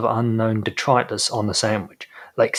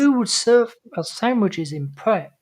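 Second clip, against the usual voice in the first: high-pitched mimicry of a fretting customer; mock indignation; 9 semitones higher than usual. Mimicking the woman worried about her Pret sandwiches, mocking. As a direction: put on a high, mocking quote.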